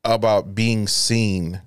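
slow, low mock of her complaint; mimicking girlfriend. Boyfriend mimicking his girlfriend's annoying phrase, slow mock earnestness. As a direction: imitate her pet phrase, drawn out. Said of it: "the way she says it".

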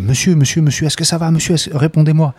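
high, loud panicked calls to the passenger; panicked calling. Retold or imitated. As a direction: call him urgently, in a panic. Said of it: "Moi je panique".

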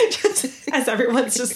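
ordinary talk

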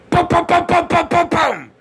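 high, loud cannon booms, mouth-made; imitated tank fire. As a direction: go pum pum like a firing tank gun. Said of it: "Hey, get a tank".

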